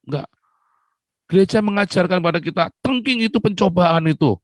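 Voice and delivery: preacher rebuking the church; fiery preaching. Acted. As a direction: drive the point home to the congregation. Preaching at full force.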